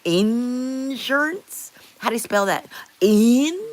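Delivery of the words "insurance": insurance stretched very slowly with a rise; puzzled, slow in-SUR-ance; drawn-out insurance. Shown as a demonstration. Streamer to chat, puzzled and stretching out insurance. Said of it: "In Canada, we call it insurance".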